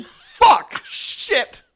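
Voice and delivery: high, loud screamed swearing; acted-out scream of pain; modern scream. Shown as a demonstration. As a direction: scream it out as if in agony. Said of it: "we know what screams sound like now".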